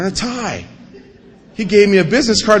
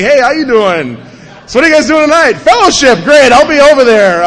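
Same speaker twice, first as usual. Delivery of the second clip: eager shout; 7 semitones higher, 9 dB louder than usual. Retold or imitated. Eager approach to the dealers.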